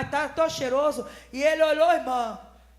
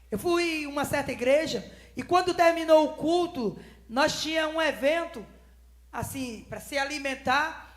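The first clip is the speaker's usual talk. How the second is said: ordinary talk, nothing marked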